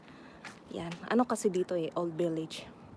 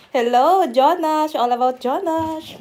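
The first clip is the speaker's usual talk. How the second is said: ordinary delivery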